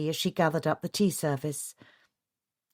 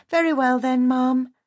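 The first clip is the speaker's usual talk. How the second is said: bright, higher servant voice; cheerfully attentive housekeeper; 8 semitones higher, 5 dB louder than usual. A narrator's reading. Housekeeper again.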